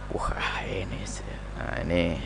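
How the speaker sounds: hushed, breathy gossip; a whispered aside to the people next to you; gossipy whisper. Retold or imitated. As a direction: whisper it behind his back. Gossiping about a showy donor.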